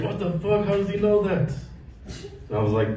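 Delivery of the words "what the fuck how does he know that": a higher, lightly voiced startled dad; blurting it out like a caught father; shocked dad. Dad caught out by his grandson's question, higher startled blurt.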